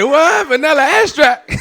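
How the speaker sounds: high, loud incredulous cry with laughter; mock incredulous woman, laughing. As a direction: disbelief at the stripper name. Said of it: "so she said".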